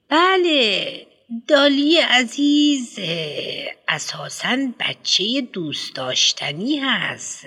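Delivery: a pompous headmistress lecturing a parent; fussy headmistress. Acted. Headmistress praises Dolly.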